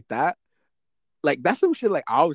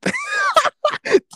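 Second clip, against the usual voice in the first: very high, real laugh; amused laugh. Real, not acted. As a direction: burst out in a high-pitched laugh. Amused by the harsh insult.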